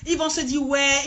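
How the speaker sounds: ordinary delivery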